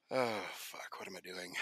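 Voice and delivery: sighed, fading mutter of regret; Gregor's dismayed aside. Acted. Gregor looking back as he walks to the ring, dismayed mutter. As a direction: mutter it to yourself, trailing away. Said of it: "Gregor looks back and is just like".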